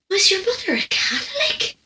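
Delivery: high, astonished imitation of a nosy woman; scandalised question. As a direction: ask it high-pitched, aghast. Mimics shocked woman's nosy question.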